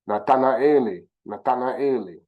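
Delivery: careful Kikongo "Nathanaeli"; Kikongo pronunciation. Shown as a demonstration. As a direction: sound out "Nathanaeli" syllable by syllable. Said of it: "is how you would say Nathaniel".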